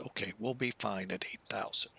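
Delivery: under his breath; whispering. Real, not acted. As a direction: whisper it to yourself. Unvoiced under-breath remark.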